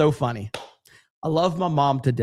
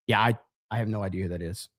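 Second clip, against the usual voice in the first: low, quick, softer throwaway mutter; muttered aside; 6 semitones lower, 6 dB quieter, faster than usual. Real, not acted. Streamer muttering to himself after reading a chat message. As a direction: mumble it under your breath, half to yourself.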